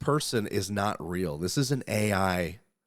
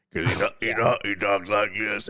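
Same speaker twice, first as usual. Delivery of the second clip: Don Fry impression; 5 dB louder than usual. Host imitating the rugged fighter for co-hosts, rough diesel growl. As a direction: rumble it out like a road-flare-smoking cowboy fighter. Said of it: "Don Fry talks in fucking Ford F three fifty diesel".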